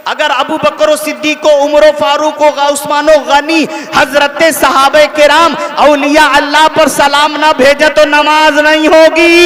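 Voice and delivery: loud sermon build on a list of names; preacher's rising volume, driving to the end; impassioned preaching. Real, not acted. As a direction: build to a forceful, ringing conclusion. Preaches at full force.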